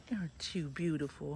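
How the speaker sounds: ordinary talk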